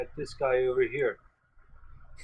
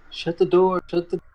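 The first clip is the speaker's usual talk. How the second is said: louder, higher in-character shout; acted shout; 5 semitones higher, 6 dB louder than usual. Player shouts his character's order to shut the door, acted shout. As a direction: call out an urgent order, raised and higher. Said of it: "I shout".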